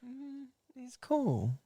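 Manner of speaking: high soft slow teasing. Real, not acted. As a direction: coo it to wind him up. Teasing his friend.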